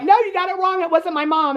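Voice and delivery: high-pitched protesting daughter; mock pleading. Retelling a daughter defending her mom, mock pleading. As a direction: plead it high, acting out the girl.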